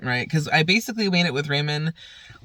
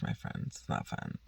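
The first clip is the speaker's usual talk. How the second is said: whispered aside; 15 dB quieter than usual. Real, not acted. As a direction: drop to a whisper for the punchline. Crude joke as a whispered aside.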